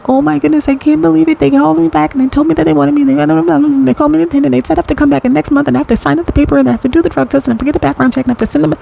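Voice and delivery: high, breakneck excited gushing; thrilled good news. Acted. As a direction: gush it out like phoning someone with amazing news. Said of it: "got off the phone getting some really good news".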